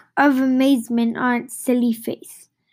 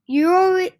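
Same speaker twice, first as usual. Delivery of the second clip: higher, moderately raised yell; 6 semitones higher, 4 dB louder than usual. A narrator's reading. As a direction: accuse him in a mild yell. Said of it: "Grandma yelled".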